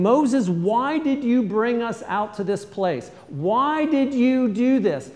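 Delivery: high, loud, slow complaining people; grumbling crowd. Retold or imitated. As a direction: whine and accuse Moses. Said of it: "they're always asking".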